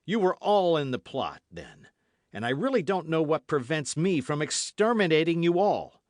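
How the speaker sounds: loud, high threat; menacing threat. A narrator's reading. D'Artagnan threatening the host, loud menace. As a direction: threaten him fiercely.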